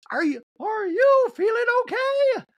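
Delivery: very high, loud, slow falsetto; hammed-up squealed concern; Dorit. Dorit checking on Sutton, loud high mock voice. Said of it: "Dorit moves over to Sutton and goes".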